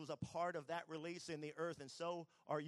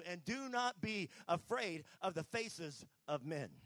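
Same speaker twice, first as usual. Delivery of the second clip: louder, rising preacher's command, slowing at the end; forceful preaching; 3 semitones higher, 7 dB louder than usual. Retold or imitated. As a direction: declare it like God commissioning a prophet. God's voice preached.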